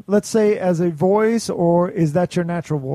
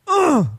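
higher-pitched mock "ugh"; mock disgusted groan; 12 semitones higher, 4 dB louder than usual. Host joking about his dad's reaction, mock disgusted groan. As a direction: groan it high, as a joke. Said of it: "and he said".

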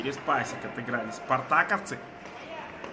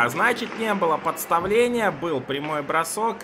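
excited; 5 semitones higher, 5 dB louder than usual. Real, not acted. Announcing the goal scorer.

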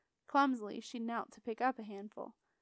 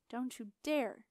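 ordinary delivery

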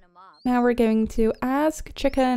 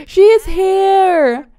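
thrilled; 6 semitones higher, 8 dB louder, slower than usual. Real, not acted. Thrilled a favourite Sim appears.

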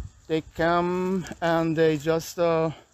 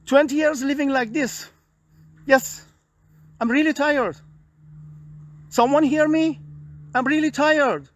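higher, straining voice, begging to be heard; weary desperate plea; 7 semitones higher than usual. Real, not acted. Man in the park pleads to be heard, worn out and desperate. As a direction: cry out that you can't take it anymore.

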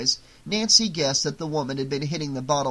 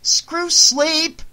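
very high, loud furious scream; 13 semitones higher, 8 dB louder than usual. Acted. Nancy raging at her mother about sleep, screaming. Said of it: "screamed Nancy".

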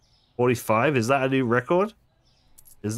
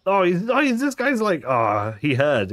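much higher, louder excited blurting; 8 semitones higher, 4 dB louder than usual. Real, not acted. Cashier delighted a customer heard about the flour, excited higher. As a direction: gush with sudden delight.

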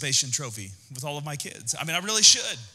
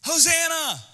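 much higher, louder cheering crowd voice; mock cheering crowd; 12 semitones higher, 4 dB louder than usual. Preacher mimics Palm Sunday crowd, high hammed-up cheer. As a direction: cheer like a fan who will soon turn. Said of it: "they were like".

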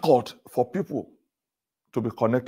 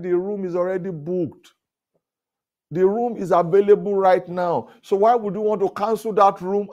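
higher, firmer indignant complaint; arguing with the hotel supervisor, indignant and higher; retold protest; 7 semitones higher, 3 dB louder than usual. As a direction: push back on the supervisor, rising in pitch.

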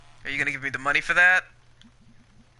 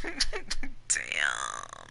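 ordinary delivery

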